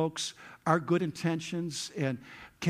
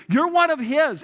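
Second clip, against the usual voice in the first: accusing shout; 11 semitones higher, 9 dB louder than usual. Retold or imitated. Accuser in courtyard.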